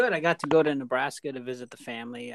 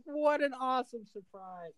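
tearful joy; 11 semitones higher, 4 dB quieter than usual. Retold or imitated. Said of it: "and she started crying".

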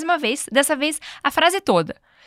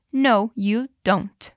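very slow, careful No, you don't; slow teacher model, short form; slow short reply; much slower than usual. Shown as a demonstration. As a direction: draw No, you don't out for learners. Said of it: "pra não repetir tudo isso de volta, fica só".

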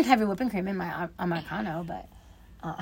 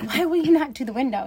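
exasperated mom; 7 semitones higher, 3 dB louder than usual. Retold or imitated. Mother questioning her reluctant son.

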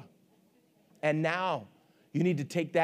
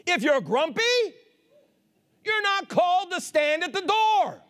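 emphatic preacher voice, higher than normal talk; full-force preaching; 9 semitones higher than usual. Real, not acted. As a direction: punch each phrase out to the congregation.

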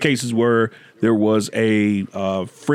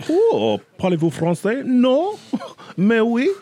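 much higher, hammed-up French accent; 10 semitones higher than usual. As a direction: flirt with the ladies in a fake French accent. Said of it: "I just happen to have the French accent".

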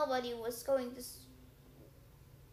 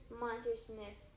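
plain reading voice

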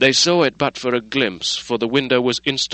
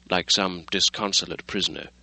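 ordinary delivery

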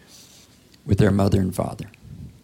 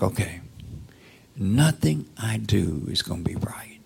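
whispered hopeless self-talk; defeated whisper; 3 semitones lower, 5 dB quieter than usual. Retold or imitated. Preacher voices a listener's defeated thoughts in a whisper. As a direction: mutter it to yourself, beaten down. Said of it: "you're always feeling like".

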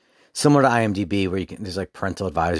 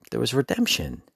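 ordinary delivery

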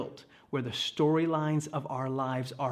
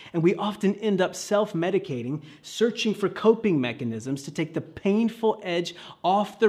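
louder and higher, driving the point home; full-force preaching; 4 semitones higher, 5 dB louder than usual. Real, not acted. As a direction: preach it with rising intensity.

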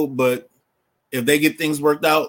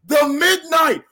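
wrestling manager voice; 12 semitones higher, 3 dB louder, slower than usual. Acted. Wrestling manager intro bit.

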